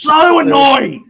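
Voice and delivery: really fed up, shouting it; annoyed shout. Real, not acted. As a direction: let the frustration burst out loud. Venting anger about the game.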